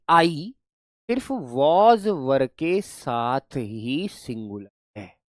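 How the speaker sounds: very slow, each word spaced out; very slow summary. Shown as a demonstration. Teacher summing up the rule for students, very slowly. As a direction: spell out सिर्फ was were के साथ ही singular deliberately. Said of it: "all in all मैं ये कह सकता हूं".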